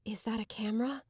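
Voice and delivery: hushed unvoiced whisper. A narrator's reading. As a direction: whisper it secretly. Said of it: "I whispered".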